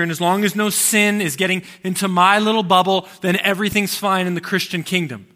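loud, high, emphatic declaration; impassioned preaching. Acted. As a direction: preach it out at full force. Preaching at full force, mocking himself.

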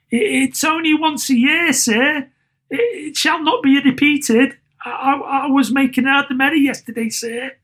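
high, stammering apology; nervous clerk. A narrator's reading. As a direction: plead timidly with the boss.

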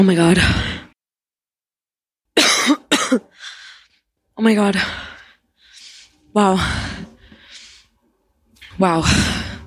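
winded after a big hit; out of breath. Real, not acted. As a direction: speak while still catching your breath. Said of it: "out of breath I've been".

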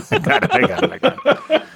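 breaking into laughter while repeating; giggling through the words, pitch jumping up; laughing. Real, not acted. Host cracking up while insisting he has it, laughing.

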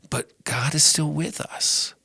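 whispered, barely voiced; whispered comfort. Real, not acted. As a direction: hold it in a whisper through grief. Grief over father's death.

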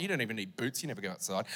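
low, soft snide mutter; quiet snide dig at the doctor; muttered sarcasm. Retold or imitated. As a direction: mutter it under your breath. Sarcastic aside about doctor's boots.

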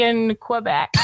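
put-on playful French accent on Quebec. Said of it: "With my little French accent".

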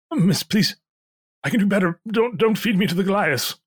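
high, pleading innkeeper voice; nervous innkeeper. Acted. Innkeeper Pierre begging for his life.

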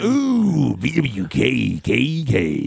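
slow mocking coo; teasing drawl. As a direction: draw the name out teasingly. Teasing name drawn out.

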